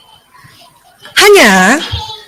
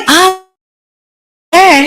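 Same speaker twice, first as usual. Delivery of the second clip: usual speaking voice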